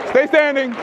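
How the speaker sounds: normal talking voice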